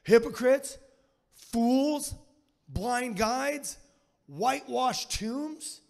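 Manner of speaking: loud high-pitched scolding. Retold or imitated. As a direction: shriek the insults up high. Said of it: "his voice was a little high".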